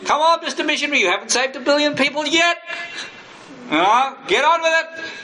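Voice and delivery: the devil's voice. Acted. Preacher voices the devil rushing the missionary, high and taunting. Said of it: "the devil says".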